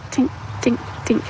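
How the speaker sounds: light high tink tink, imitating a faint metal noise; imitated tinking. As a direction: mimic the tiny engine tink softly. Imitating engine noise in story.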